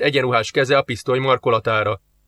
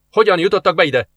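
louder, higher stern question; guard's repeated demand; 6 semitones higher, 4 dB louder than usual. Acted. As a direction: insist again. Guard asks again.

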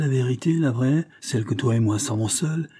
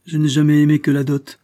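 loud, raised indignant denial; heated, higher-pitched protest; angry retort; 5 semitones higher, 7 dB louder than usual. A narrator's reading. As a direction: snap back in anger. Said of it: "le mouvement de colère".

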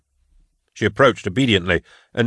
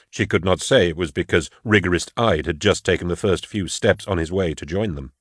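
usual speaking voice

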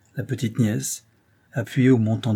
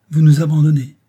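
louder, higher, lips pressed in reproach; a wounded matron accusing; pinched reproach; 5 semitones higher, 10 dB louder than usual. Acted. Madame Brun to the engineer, pinched and reproachful. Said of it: "fit madame Brun en pinçant les lèvres".

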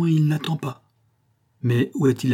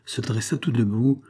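ordinary delivery

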